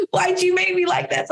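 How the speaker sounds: high, fast exasperated mom voice; a frustrated mother losing it at her son; retold angry outburst. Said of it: "So then I got so angry".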